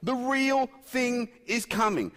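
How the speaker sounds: loud, high, slow proclamation; eager anticipation. Acted. Preacher voicing the expectant martyrs to his congregation, eager. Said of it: "They're like".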